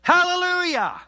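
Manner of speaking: very high, loud Hallelujah shout. Real, not acted. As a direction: shout it to the rafters. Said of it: "What would a shout sound like".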